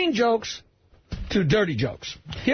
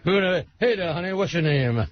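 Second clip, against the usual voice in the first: [name] impression; 4 semitones lower than usual. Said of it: "[name] is on stage".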